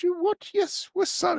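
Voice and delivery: high-pitched very English mom voice; very English mother. Retold or imitated. English mother answering her excited son, high English accent. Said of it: "very English".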